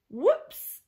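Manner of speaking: sudden high startled yelp; a quick shocked little cry; alarmed alien yelp; gasped whoops. Acted. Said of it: "the aliens gasped".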